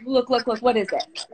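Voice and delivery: usual speaking voice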